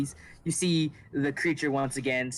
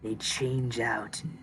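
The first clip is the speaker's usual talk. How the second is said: creature voice; 2 semitones lower than usual. Acted. Creature answers about the manor.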